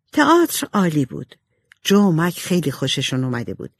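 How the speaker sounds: plain reading voice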